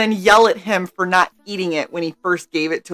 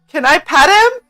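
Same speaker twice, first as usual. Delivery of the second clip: put-on high, louder little kid asking; eager kid; 9 semitones higher, 4 dB louder than usual. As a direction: play a hopeful child.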